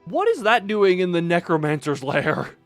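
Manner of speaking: loud disbelieving outburst; baffled and laughing in disbelief; incredulous. Real, not acted. Shocked at diamond pickaxe.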